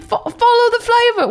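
high, quick mascot catchphrase; put-on cereal mascot voice; Toucan Sam. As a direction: pitch it up like a cartoon bird. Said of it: "he was like".

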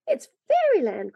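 put-on high-pitched, wonder-struck little boy; joyful cry. A narrator's reading. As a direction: cry out, overjoyed. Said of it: "cried little Davy, out of his wits with joy".

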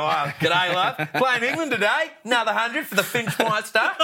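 high, loud Aussie accent impression; chirpy Australian bloke greeting his wife. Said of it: "I don't know if he calls himself the Finchmeister".

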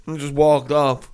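ordinary talk, nothing marked

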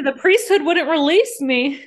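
hammed-up dramatic quote; mock quote. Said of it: "But did she say".

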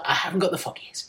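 whispered, small and clueless; sheepish whisper. Retold or imitated. Answering the headmaster as a boy, whispered. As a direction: breathe it like a baffled little boy. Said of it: "And I was like".

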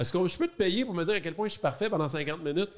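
asking through laughter; half-laughing joke question; giggling while asking. Real, not acted. Joking request while laughing.

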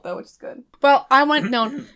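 ordinary talk, nothing marked

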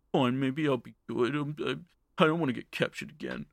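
small, soft sulky voice; acted quiet, grudging surrender; reluctant concession. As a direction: give in meekly, under your breath. Acted grudging surrender when recaptured.

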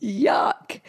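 ordinary talk, nothing marked

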